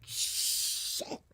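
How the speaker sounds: hushed, voiceless swear; whispered curse. Retold or imitated. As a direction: whisper it in dismay. Dreading a forgotten meeting text.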